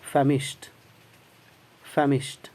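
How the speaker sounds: फेमिश्ड said with a clear sh and ending in a crisp t sound; फेमिश्ड, final d spoken as t; famished with a final t. Shown as a demonstration. Teacher showing the class फेमिश्ड ends in a t sound. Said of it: "ऐसा ही पढ़ेंगे".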